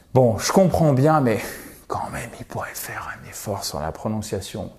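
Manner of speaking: inner grumble, sinking to a whisper; English speaker's private thoughts. Acted. Teacher imagines an anglophone's thoughts about learners, hushed aside. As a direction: think it aloud, ending hushed. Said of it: "dans la tête d'un anglophone".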